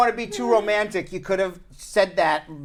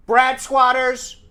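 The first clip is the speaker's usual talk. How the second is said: slow Long Island drawl on squatters; Long Island accent; 4 semitones higher, 3 dB louder, much slower than usual. Shown as a demonstration. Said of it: "he says my Long Island accent".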